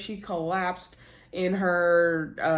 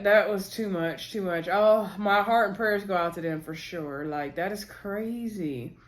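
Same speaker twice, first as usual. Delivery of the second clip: ordinary talk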